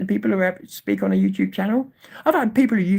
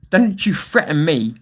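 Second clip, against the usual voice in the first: real angry threats. Real, not acted. Streamer warning off a viewer who threatened a slap, furious. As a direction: threaten in earnest, hammering the swear words. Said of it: "'cause of me shouting like that".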